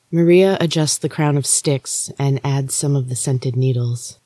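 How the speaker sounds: ordinary delivery, nothing marked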